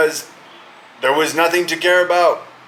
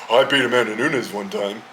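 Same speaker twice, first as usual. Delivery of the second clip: mocking Pena voice; 7 semitones lower than usual. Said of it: "you have to say".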